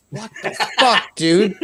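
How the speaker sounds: laughing through the words; half laughing in disbelief. Retold or imitated. Retold shock while laughing.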